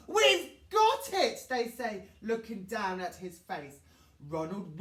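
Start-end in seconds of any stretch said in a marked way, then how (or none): 0.1-1.4 excited. Acted. Friends announce their plan.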